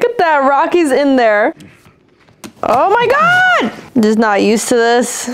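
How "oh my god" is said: high, stretched-out gasp of words; real shock, pitch shooting up; shocked exclamation. Real, not acted. Viewer reacts to the fight on screen, shocked.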